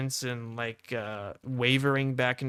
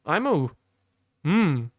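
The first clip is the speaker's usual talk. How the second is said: louder, higher halting I'm a's; stuck on a loop; 4 semitones higher, 6 dB louder, slower than usual. Retold or imitated. Imitating the dazed friend who can't finish his words. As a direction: stall out mid-word like a skipping record. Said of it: "He's literally on his, on a loop".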